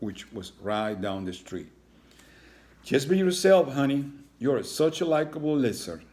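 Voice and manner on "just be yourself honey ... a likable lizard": high, loud, sweet reassurance; encouraging mom. Acted. Mother dropping son at school, high and encouraging. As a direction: send him off with a pep talk.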